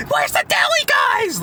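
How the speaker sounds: very high, drawn-out mocking shout; mock screaming. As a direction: imitate a screaming customer calling for staff. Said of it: "like, screaming".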